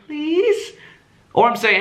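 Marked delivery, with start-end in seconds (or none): 0.1-0.7 high rising PLEASE; needy pleading. Shown as a demonstration. Showing how needy tone sounds, high pleading. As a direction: beg upward on PLEASE. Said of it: "it sounds like I'm saying".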